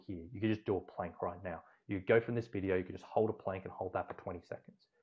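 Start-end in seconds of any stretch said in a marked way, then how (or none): none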